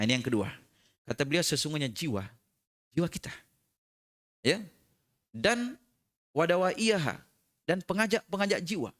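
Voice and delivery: ordinary talk, nothing marked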